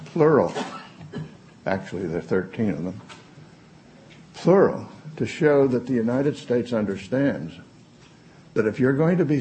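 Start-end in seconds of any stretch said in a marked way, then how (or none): 0.1-0.5 "plural." punched out loud and high; stressed word. Real, not acted. Stressing the arrows are plural. 4.3-4.9 "Plural," hit loud and high; emphatic repeat. Real, not acted.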